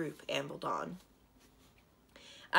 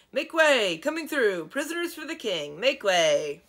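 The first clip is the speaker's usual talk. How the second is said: loud, high shouts clearing a path; dragon shouting; 5 semitones higher, 9 dB louder than usual. Acted. Escort dragon shouting at the crowd to make way, loud shout. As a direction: bellow to push through the crowd. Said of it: "raised his voice and shouted".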